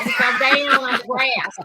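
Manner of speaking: slow Southern drawl on grass; Southern accent. Retold or imitated. Mother imitating son's Alabama accent. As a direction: stretch the vowels like an Alabama kid. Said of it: "Like that".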